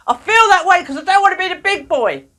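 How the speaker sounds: yelled out. Retold or imitated. Boy finally yelling the truth at the therapist, loud and high. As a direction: blurt it out at full volume like a boy at breaking point. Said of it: "he just yelled out".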